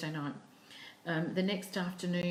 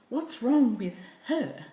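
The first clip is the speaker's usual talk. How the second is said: slow, higher, louder worried ask; mock-worried question, higher pitch; concerned question; 6 semitones higher, 6 dB louder, slower than usual. Quoting herself asking concern.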